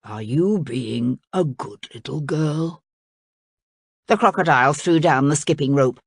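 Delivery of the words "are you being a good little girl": slow, low, sorrowful reproach; sad voice. A narrator's reading. King squatting before his daughter, asking sadly. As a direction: ask it slowly, like a let-down parent. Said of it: "in the sad voice that Princess Cora hated most".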